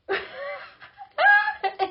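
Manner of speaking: high, loud shrieking laugh. Retold or imitated. Friend reliving the video, a high shrieking laugh.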